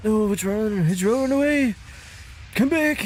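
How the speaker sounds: high-pitched call after a fleeing creature; real surprise as the prey runs off; excited calling out. Real, not acted. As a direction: call it back, rising and urgent. Game creature flees, calls after it.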